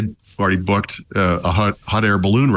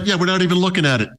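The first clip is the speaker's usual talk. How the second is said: dismissive mock Sony voice; 10 semitones higher, faster than usual. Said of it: "Sony was like".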